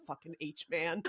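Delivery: low, soft mock grumble; retold angry grumble. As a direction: grumble it under your breath. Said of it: "angrily walking on my treadmill".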